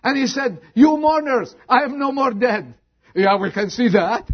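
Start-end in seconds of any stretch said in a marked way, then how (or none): 0.7-2.7 joyful proclamation. Acted. Resurrected young man addressing mourners.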